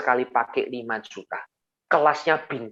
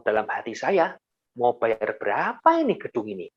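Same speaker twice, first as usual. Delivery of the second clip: ordinary talk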